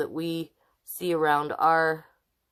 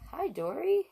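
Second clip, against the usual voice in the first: cutesy pet greeting; 12 semitones higher, 4 dB quieter than usual. Real, not acted. Greeting her cat behind her.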